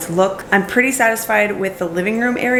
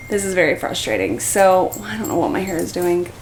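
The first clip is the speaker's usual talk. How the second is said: ordinary talk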